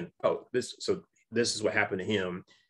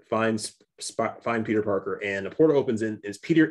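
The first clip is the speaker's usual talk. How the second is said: normal talking voice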